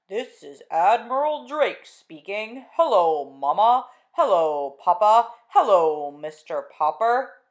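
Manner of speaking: slow, loud, distant radio announcement; Admiral Drake on radio. Acted. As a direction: broadcast across the world, slowly.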